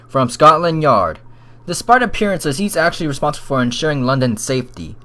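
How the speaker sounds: ordinary talk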